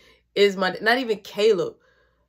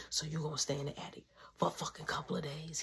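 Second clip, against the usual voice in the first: quick, hushed whisper; fast whisper; 6 semitones lower, 14 dB quieter, faster than usual. Retold or imitated. Urging brother to tell mom, fast whisper.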